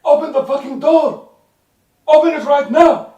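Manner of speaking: loud, high-pitched soldier's shout; furious shouted order. Acted. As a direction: bellow it through a locked door. Soldiers pounding on door.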